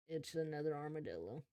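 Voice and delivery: ordinary talk, nothing marked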